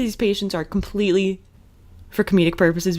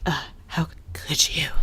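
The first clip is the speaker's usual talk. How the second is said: whispered mock disgust; 3 semitones lower, 7 dB quieter than usual. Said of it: "her mom is just like".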